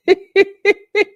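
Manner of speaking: high loud laugh; burst of laughter. Real, not acted. Host bursts out laughing at dark pun. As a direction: crack up at the pun.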